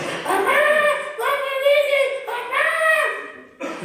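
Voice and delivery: put-on high, whiny crying little kid at the kinder door; crying child. Toddler left at kinder calls for mama, whining high. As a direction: wail for mama like a three-year-old.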